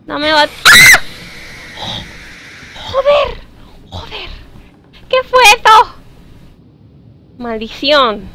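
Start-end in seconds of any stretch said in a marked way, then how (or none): 0.5-1.0 loud, high scream of fright; startled scream. Real, not acted. Susto del juego. 2.9-5.9 high-pitched shaken swearing; real fright. Real, not acted. Reacción tras el susto.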